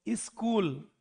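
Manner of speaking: स्कूल said as इस्कूल, slow with added i; Bihar accent. Retold or imitated. Teacher imitating Bihari students' इस्कूल, Bihar accent. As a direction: drawl इस्कूल with a leading i, Bihari. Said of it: "वो ई जोड़ता है सब चीजों में".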